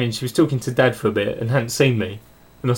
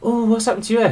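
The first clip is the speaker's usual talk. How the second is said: higher-pitched retold surprise; dad's surprised question; 9 semitones higher, faster than usual. Son imitating his dad noticing the haircut, surprised. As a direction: act out a puzzled dad's reaction. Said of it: "my dad went".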